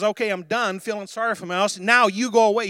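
emphatic, fed-up declaration; taking authority. Acted. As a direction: rise up and order them off. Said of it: "He's taken authority".

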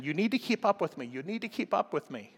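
a hint of a high, nagging mother; lightly voiced mom urging her kid along. Mom in the store telling her little girl to keep up, mild nag.

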